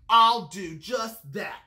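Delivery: loud, drawn-out grouchy retort; story-time screech of a grouchy bug. A narrator's reading. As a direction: screech it slowly and defiantly. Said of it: "screeched the grouchy ladybug".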